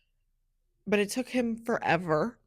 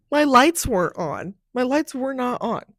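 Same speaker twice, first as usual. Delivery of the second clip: ordinary talk, nothing marked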